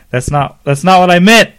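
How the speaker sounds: loud, high protest; yelling back. A narrator's reading. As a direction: shout him down, defensive. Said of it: "Ryan yelled back".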